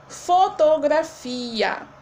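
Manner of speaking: slow, drawn-out "Fotografia" before splitting it; whole word, slowly. Shown as a demonstration. Teacher shows pupils the word, slowly drawn out. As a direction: stretch "Fotografia" out for the class. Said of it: "Quando nós separamos essa sílaba".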